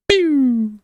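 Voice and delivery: very high, loud "pew" sound; laser pew. Pretend. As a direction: make the blaster noise. Imitating a missile shot.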